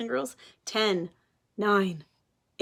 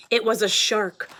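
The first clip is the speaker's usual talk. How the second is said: ordinary delivery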